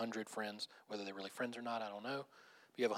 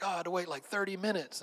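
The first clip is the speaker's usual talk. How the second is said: high-pitched mock complaint; frustrated passenger; 7 semitones higher than usual. Passenger stuck waiting on the runway, mock frustrated. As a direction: grumble theatrically as the delayed traveler. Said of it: "people being frustrated waiting on the runway".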